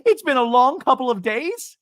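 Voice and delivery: high, loud mock protest; hammed-up high outburst; Alexis. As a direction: impersonate a worn-out drama queen at full volume. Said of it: "Alexis goes".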